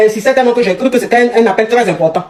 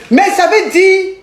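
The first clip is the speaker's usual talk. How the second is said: high, drawn-out mock outrage; indignant disbelief; 9 semitones higher, much slower than usual. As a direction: protest it high-pitched, stretching the words. Indignant reaction to the girl's words.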